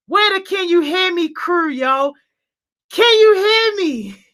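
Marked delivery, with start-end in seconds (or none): 2.9-4.3 higher, calling into the void. Real, not acted. Streamer testing if chat hears, high call. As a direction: ask it like shouting across a room.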